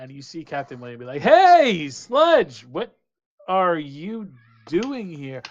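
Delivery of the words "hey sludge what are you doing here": high, loud hail; big surprised holler; calling out. Acted. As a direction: shout across the deck to get attention. Captain calls out to goblin.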